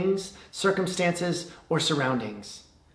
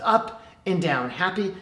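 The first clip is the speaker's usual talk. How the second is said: normal talking voice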